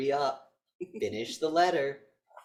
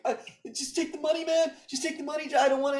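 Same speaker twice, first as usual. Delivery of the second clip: put-on scared kid pleading; faster than usual. Kid begs the killer, high panicked plea. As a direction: play a frightened kid begging a man to take the money.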